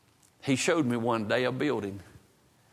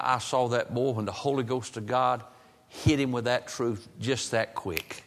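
normal talking voice

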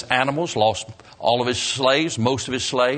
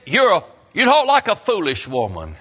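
louder and higher, hammered home; You talk like a foolish woman, said louder and higher with the word talk stressed; emphatic repeat; 7 semitones higher, 5 dB louder than usual. Shown as a demonstration. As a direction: throw the line out again, punching it for the congregation. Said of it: "But he said".

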